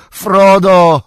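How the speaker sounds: very loud, high calling shout; głośne wołanie. A narrator's reading. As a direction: shout the name after someone running away. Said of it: "zawołał". (donośny, wysoki krzyk)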